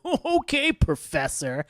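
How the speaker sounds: high mock-impressed start, dropping away; sarcastically bow to the expert; exaggerated patronizing tone; condescending. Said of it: "even more fucking condescending".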